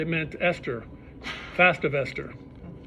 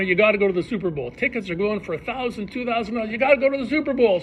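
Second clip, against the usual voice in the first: pushy, fast and rising higher; urging; 5 semitones higher, faster than usual. Retold or imitated. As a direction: talk him into going, fast and eager. Urging him to go.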